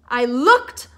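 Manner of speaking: ordinary delivery, nothing marked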